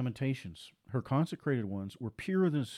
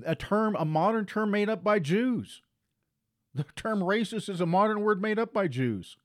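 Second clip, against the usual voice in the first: raised, emphatic pulpit delivery; full-force preaching; 8 semitones higher, 5 dB louder than usual. Acted. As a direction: drive each line home to the congregation.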